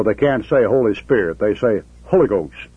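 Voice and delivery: ordinary talk, nothing marked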